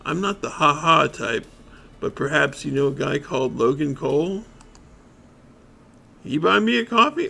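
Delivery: lightly voiced cranky street bum; grumpy bum. Bum grumbles at the detective about coffee, lightly cranky. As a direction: grumble like a tired vagrant. Bum character keeps talking.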